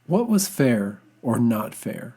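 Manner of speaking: ordinary delivery, nothing marked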